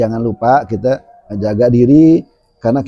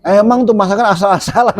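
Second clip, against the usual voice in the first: a higher, quick, catty gripe about the food; mock complaining; 7 semitones higher, faster than usual. A guest mocking the cook's food at the gathering, catty. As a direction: sniff at the dish like a picky guest.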